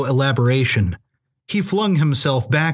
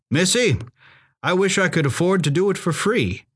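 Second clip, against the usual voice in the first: higher-pitched character voice, wryly agreeable; Aloysius, obliging hired driver; 5 semitones higher than usual. A narrator's reading. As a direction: play a folksy man taking the deal.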